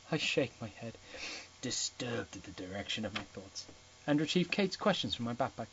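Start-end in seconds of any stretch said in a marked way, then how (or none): none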